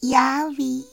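very high tiny fairy voice hiding her tears; little fairy. Acted. Fairy tells the horse it is just a new fashion, tiny high voice.

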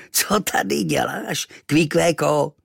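slow, high, odd character voice; grinning native. A narrator's reading. Amused stranger questioning the boy, grinning. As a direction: ask it slowly through a broad grin. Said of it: "chechtal se teď od ucha k uchu".

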